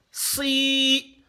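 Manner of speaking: "Si" held long and high; drawn-out vowel. Shown as a demonstration. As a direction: stretch the i of "Si". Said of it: "Si on veut tirer le".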